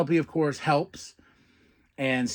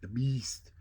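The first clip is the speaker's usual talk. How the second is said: softer, lower gruff pep talk; a hint of a low mumbled push; lightly voiced Hook; 4 semitones lower, 7 dB quieter than usual. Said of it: "He's like".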